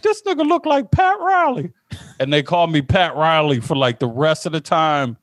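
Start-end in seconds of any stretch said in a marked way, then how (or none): 0.0-1.7 mocking coworker. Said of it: "he was like".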